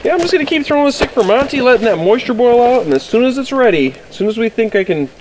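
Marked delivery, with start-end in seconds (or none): 0.1-2.8 upbeat excited. Real, not acted. Excited fast cooking talk.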